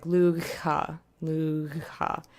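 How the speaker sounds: "lugha" with a voiced, throaty gh; fricative gh sound. Shown as a demonstration. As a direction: rasp the gh of "lugha" far back in the throat. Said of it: "that's what it sounds like".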